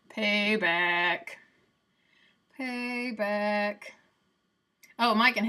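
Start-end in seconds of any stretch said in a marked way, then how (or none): 0.1-3.8 drawn-out, savoured gloat; mock gloating. Youngest sibling relishing being the known one for once, mock gloating. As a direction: stretch each word out in playful triumph. Gloating imagined reply to sister comparisons.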